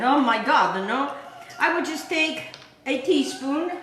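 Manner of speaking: ordinary talk, nothing marked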